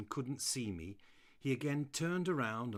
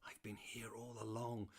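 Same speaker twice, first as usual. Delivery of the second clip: whispered thought; 6 dB quieter than usual. Acted. Dog's silent thought.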